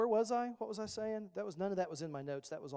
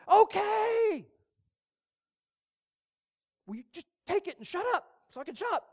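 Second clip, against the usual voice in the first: much higher, loud, fast exasperated outburst; fed-up mom; 12 semitones higher, 10 dB louder than usual. Retold or imitated. Mom at the grocery store finally caving to her nagging kid, exasperated. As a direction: give in with a worn-out cry.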